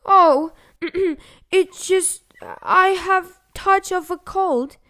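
high, slow squawk with throat-clearing; squawking wolf. A narrator's reading. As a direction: squawk like a fake granny feigning a cold. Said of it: "squawked the wolf, adding a cough".

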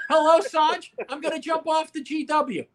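high-pitched pretend desperate caller; mock hotline caller. Caller phoning Sanj's hotline, high mock distress. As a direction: announce it like a hammed-up plea for help. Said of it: "this would be it".

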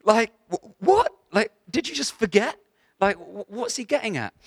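high, loud, stammering bafflement; acting out utter confusion at what was heard; retold incredulity. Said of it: "And I'm like".

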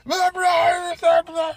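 high, loud yappy "brr" barks; loud, high mock barking; imitating dog barking. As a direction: bark like an excited dog at the door. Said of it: "I open the door".

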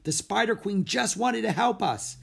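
put-on high, excited girl; thrilled, lifted high and loud. A narrator's reading. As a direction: gush with relief and delight. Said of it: "She sounded thrilled".

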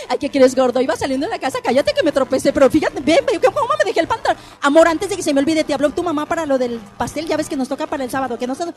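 loud, fast chattering wife voice; babbling wife dodging a simple yes or no; rambling wife. Pretend. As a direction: gush on without answering the question. Wife rambling reply, acted.

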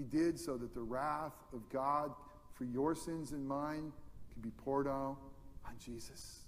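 normal talking voice

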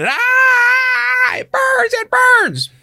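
shrieked very high and loud, hammed-up pain; mock pained scream. Mom faking a cigarette burn for her kids, screaming. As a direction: imitate a fake burn victim's shriek. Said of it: "she's sitting there screaming".

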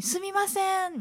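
high, apologetic すみません; typical sumimasen. Retold or imitated. Nurse calling to a patient, high apologetic すみません. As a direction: chirp すみません up high and timid. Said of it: "みたいな".

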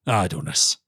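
low royal echo of IDLENESS; deep, displeased monarch; affronted king. Acted. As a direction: repeat the word back in a low, kingly voice. King echoes the word, affronted.

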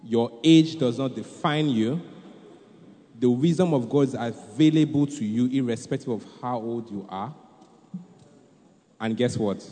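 usual speaking voice

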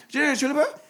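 high, garbled mock Scottish voice; thick Scottish accent. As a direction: imitate a barely intelligible Scottish waitress. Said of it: "she's got a real thick Scottish accent".

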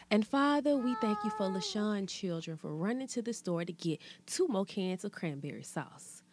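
ordinary delivery

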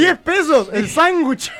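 mock vendor. Vendor bit in comic exchange.

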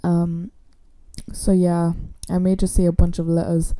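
ordinary talk, nothing marked

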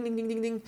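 high sing-song ding ding, like a little bell; bell imitation. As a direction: chime it out high and light. Imitating the dog's collar bell again.

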